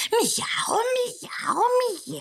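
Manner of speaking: very high, cat-like meows; angry meowing. Acted. Cat yowling at the girl from the doorway, high and cross. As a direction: yowl like an offended cat. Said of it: "haragosan karmizsálta az ajtófélfát".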